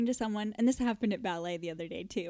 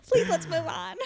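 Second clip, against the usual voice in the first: higher, louder half-laughed begging; laughing plea; 9 semitones higher, 5 dB louder than usual. Real, not acted. Host begging co-host to drop chocolates, laughing plea. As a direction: plead through a laugh to change topic.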